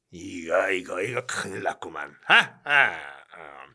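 low, soft troubled grumble; worried low mutter. Acted. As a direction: brood over the money.

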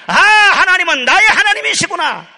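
joyful realization. Acted. Imagined believer's joyful realization.

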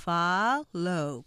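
slow "Follow", FA held and punched; stress on first syllable. Shown as a demonstration. Teacher repeating the model for learners, "Follow" stressed on FA. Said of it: "앞에 강조하셔야 되죠".

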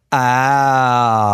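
"ah" moving to the back, darker. Shown as a demonstration. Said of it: "Do you hear the movement".

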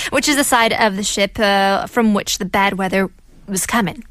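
normal talking voice

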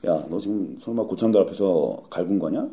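low, subdued interrogation; senior soldier confronting. Acted. Corporal confronting private about scolding, low restrained questioning. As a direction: question him quietly, with restrained menace. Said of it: "저한테 와서".

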